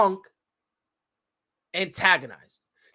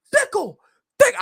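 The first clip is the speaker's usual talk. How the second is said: high, louder screamed fickle; mock madman scream; 14 semitones higher, 7 dB louder than usual. Retelling Daniel Bryan's promo, a higher mock scream. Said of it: "just screaming".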